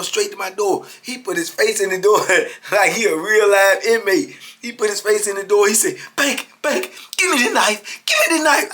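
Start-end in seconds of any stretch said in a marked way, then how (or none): 6.2-8.7 high, loud, urgent plea; pressing high-pitched imitation; retold frantic demand. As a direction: imitate a worked-up man demanding something now. Acted frantic officer demanding a knife.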